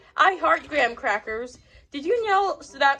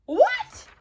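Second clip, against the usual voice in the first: shrieked much higher in disbelief; a stunned, squealing what; shocked what; 3 dB louder than usual. Acted. Teacher shocked by quacking.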